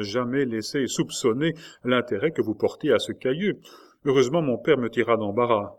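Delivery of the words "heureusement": ordinary delivery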